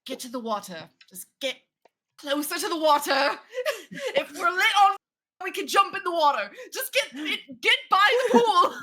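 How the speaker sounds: laughing half-shouted warning; mock yell. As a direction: yell through laughter to allies. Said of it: "I'm going to just yell".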